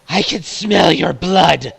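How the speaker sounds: loud, slow, high growl of hunger; a predator relishing the scent of blood; menacing monster. Acted. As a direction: loom over them and savor it. GM voices monster threatening.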